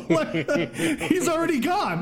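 high laughing talk. Real, not acted. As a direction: squeak it out through laughter. Laughing about the vanished robot.

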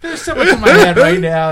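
speaking through laughter; laughing. Real, not acted. Ed laughing at his friend's teasing.

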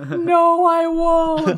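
high, loud cartoon refusal; mock defiant yell. Playing the villain yelling back a refusal, high mock yell. Joke of yelled reply, played out.

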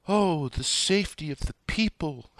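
high, theatrical mock panic; mock frantic screaming. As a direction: wail it in exaggerated fake alarm. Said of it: "this frantic screaming about".

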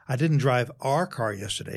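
"our" punched, as if it was another car; stress on OUR. Shown as a demonstration. Coach showing it was my own car, stress on "our". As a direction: hit the word "our" firmly. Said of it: "It was my own".

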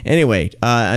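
normal talking voice

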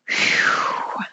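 a long breathy whew to steady herself; blowing out a breath, fighting tears; shaky exhale. Real, not acted. Mother recovering after a painful memory, exhaling shakily. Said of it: "Still really hard to say those words".

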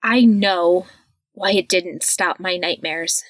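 ordinary delivery

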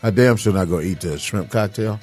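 ordinary delivery

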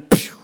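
a quick cartoon "pew" sound effect; mock zoom "pew". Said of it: "his own sound effect".

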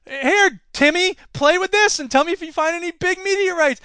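playful dad voice. Acted. Father voiced handing son dirt.

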